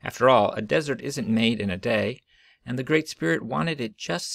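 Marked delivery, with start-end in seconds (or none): none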